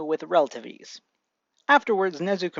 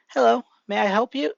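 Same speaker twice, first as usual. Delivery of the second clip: lightly voiced mother, pitched up; mother greeting; 6 semitones higher, 4 dB louder than usual. A narrator's reading. Mother opening the door to a caller, high polite greeting.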